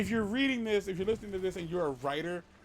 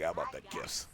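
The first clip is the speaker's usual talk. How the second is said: very low, softer caller voice; low-pitched phone stalker; creepy caller; 12 semitones lower, 7 dB quieter than usual. Acted. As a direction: drop deep and quiet, like a mystery caller. Mystery caller answering her scream.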